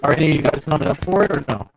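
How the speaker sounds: mic muffled by fan noise; talking into a fan. Real, not acted. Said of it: "sounds like you're talking into a fan really bad".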